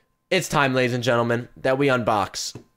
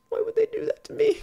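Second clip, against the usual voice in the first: much higher, whiny mock voice; squeaky whine; 3 dB quieter than usual. Streamer whining about lost item.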